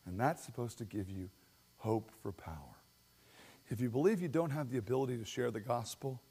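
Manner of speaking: hushed, intimate sermon voice; quiet earnest preaching. Real, not acted. Pastor pleading with his church, hushed and earnest. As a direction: lean in and speak it low to them.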